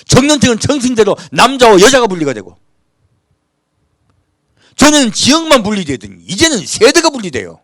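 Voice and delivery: rising, urgent pulpit voice; heated sermon delivery; fervent preaching. Acted. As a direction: rebuke the church from the pulpit. Preaching against division.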